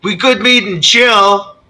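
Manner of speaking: high, loud effeminate giant voice; loud effeminate baritone. Acted. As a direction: boom it out flamboyantly. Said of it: "very loud baritone, but effeminate voice".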